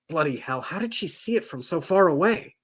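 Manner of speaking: plain reading voice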